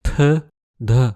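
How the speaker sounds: T and D released with a puff of air; breath burst after T and D; aspirated consonants. Shown as a demonstration. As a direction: add an h-like puff to T and D. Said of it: "c'est pour l'aspiration".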